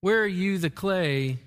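ordinary talk

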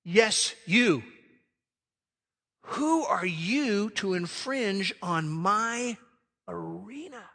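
a lightly voiced God calling someone out; a hint of God leaning in, singling out one person; lightly voiced God confronting the listener; voicing God. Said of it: "He says".

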